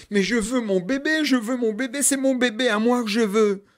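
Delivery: high, loud pleading; desperate demand. Acted. Mother begging the neighbour for her baby, high loud plea. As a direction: she wants her baby back now.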